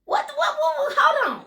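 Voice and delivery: high, loud what-what; surprised. Real, not acted. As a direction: squeal in mock disbelief. Reacts to teasing "ooh.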